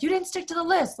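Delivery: high scolding mom voice; wife scolding husband about the grocery list, high and chiding; imitating mom. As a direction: play the nagging wife, pitched high. Mom scolding dad over groceries.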